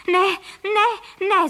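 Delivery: high, loud cry of protest; called out. A narrator's reading. Nest couple facing four aimed guns, crying out. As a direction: cry out in alarm. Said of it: "zvolali pan Frejka a paní Frejková současně".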